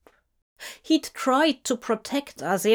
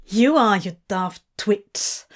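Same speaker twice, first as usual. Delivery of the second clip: spat. A narrator's reading. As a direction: spit it at him, exasperated. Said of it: "Crawley spat".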